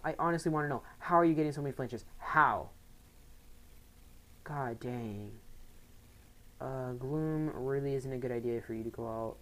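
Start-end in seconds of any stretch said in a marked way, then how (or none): none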